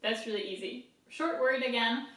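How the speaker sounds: ordinary delivery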